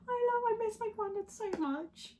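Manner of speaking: high, tearful voice, caught off guard by grief; real tearful outburst. Real, not acted. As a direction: overcome while missing a loved one. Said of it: "That literally caught me off guard".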